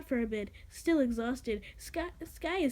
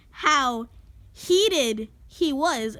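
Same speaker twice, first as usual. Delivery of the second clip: heated shouted slow, much louder; 10 dB louder, much slower than usual. Shown as a demonstration. Reader hamming it up even harder, shouting slowly. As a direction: bellow heated with gusto.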